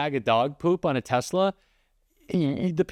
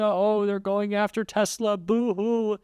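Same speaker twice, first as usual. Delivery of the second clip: mock whining; 6 semitones higher than usual. Mocking Trump's complaint.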